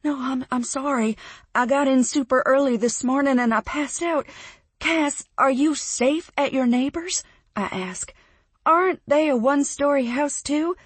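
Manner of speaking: high, loud and urgent; anxious. Acted. Narrator frantically questioning her flooded friend, high and loud. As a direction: press her for answers, rattled.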